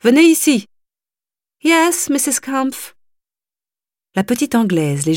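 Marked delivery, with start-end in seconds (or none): 0.0-0.7 high, loud order; commanding call. Acted. Mother ordering the governess to come. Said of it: "appela madame Kampf". 1.6-3.0 high, slow, meek reply; timid English governess. Acted. Governess answering her stern employer meekly.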